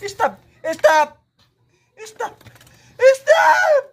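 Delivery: calls of stop rising to a shout; building referee shout. Pretend. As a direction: start firm, end in a full high shout. Stopping the toy fight.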